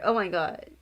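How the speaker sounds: usual speaking voice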